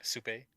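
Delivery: souper said soo-pay; Quebec souper. Shown as a demonstration. Showing how he says it, souper as soo-pay. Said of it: "Souper, that's how I say it".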